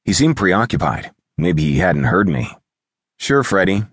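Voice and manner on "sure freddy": ordinary delivery, nothing marked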